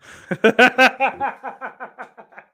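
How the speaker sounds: loud high burst of laughter; high loud cackle of real laughter; big laugh. Real, not acted. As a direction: crack up at the joke. Laughs at joke comment.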